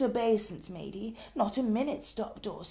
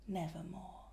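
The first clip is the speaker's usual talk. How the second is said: the raven's hushed reply; 5 semitones lower, 14 dB quieter than usual. Acted. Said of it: "Quoth the raven".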